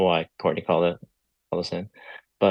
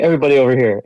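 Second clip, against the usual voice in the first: raised voice summoning everyone; calling out; 5 semitones higher, 7 dB louder, much faster than usual. Retold or imitated. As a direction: call the group over loudly. Coach calling fighters over.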